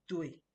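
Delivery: Dui said short and firm with a falling tone; a crisp falling Dui; Mandarin tone. Shown as a demonstration. Teacher modelling the answer yes in Mandarin, firm falling tone. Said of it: "If you watch my tones".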